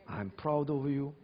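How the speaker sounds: tender praise. Acted. Tender alternative to grumbling.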